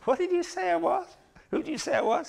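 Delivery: high put-on wife voice, indignant; wife's indignant reply. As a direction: play the offended wife demanding an answer. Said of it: "She'd say".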